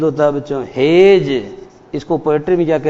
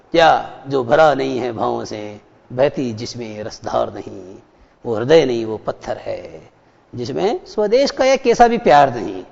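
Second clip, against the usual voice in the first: poem recitation; 6 semitones lower than usual. Shown as a demonstration.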